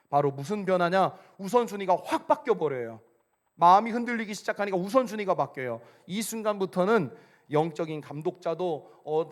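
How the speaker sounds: loud, emphatic sermon proclamation; full-force preaching. Real, not acted. Preacher warning that priorities collapse, full-force preaching. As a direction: build with volume and hammer the key words.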